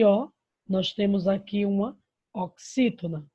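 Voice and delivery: normal talking voice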